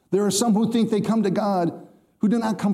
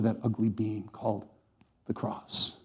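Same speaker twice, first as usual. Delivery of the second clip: hushed whisper; 8 semitones lower, 7 dB quieter than usual. Real, not acted. Preacher whispering the climax of the crucifixion to the congregation, hushed. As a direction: breathe the word out in a whisper.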